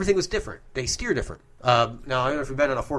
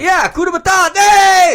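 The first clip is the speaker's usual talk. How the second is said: loud, high-pitched burst of excitement; excited shout; 16 dB louder than usual. Real, not acted. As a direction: yell it out, thrilled to remember. Excited guess at the remembered movie term.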